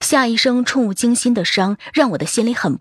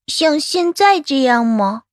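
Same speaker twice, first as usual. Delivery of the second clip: put-on higher, slow childlike question; inquisitive little child; 4 semitones higher, slower than usual. Child still questioning the doctor, slow and curious. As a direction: keep asking like a small child. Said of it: "暖暖还在问着".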